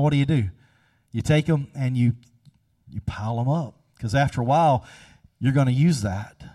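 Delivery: ordinary talk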